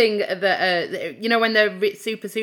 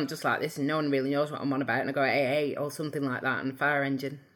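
broad English home accent, quick and quieter; thick regional English accent, rattled off; her real home accent; 6 semitones lower, 7 dB quieter, faster than usual. Shown as a demonstration. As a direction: let the broad English home accent run fast and low-key, like talking to mum on the phone. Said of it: "do you wanna hear my normal voice?".

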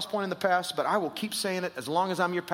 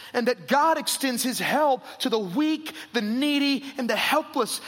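higher, louder preaching; preaching at full force; 6 semitones higher, 3 dB louder than usual. Acted. Pastor presses the congregation on God's love, full force. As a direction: drive it home to the room.

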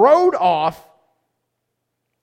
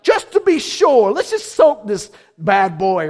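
casual Elijah; 4 dB louder than usual. Retold or imitated. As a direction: toss it off like a cocky showman. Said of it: "he's like".

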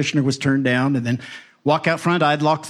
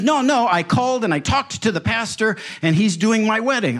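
mock insistence; 8 semitones higher than usual. Bride insists she called the pastor.

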